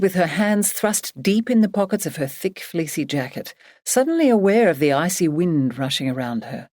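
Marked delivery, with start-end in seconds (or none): none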